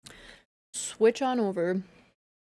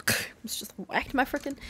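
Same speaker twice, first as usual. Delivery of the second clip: whispering; 4 semitones higher, 6 dB quieter than usual. Real, not acted. Startled, under breath.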